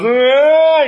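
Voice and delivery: high, loud mock child's wail; imitated crying wail. Father acting out his son refusing splinter removal, loud mock wail. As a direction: burst into a kid's exaggerated cry. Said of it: "He starts crying".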